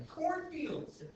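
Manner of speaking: ordinary talk, nothing marked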